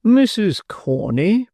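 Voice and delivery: high, slow, put-on beadle voice; a fussy official calling her name; pompous beadle voice. Beadle startled to find the matron breathless.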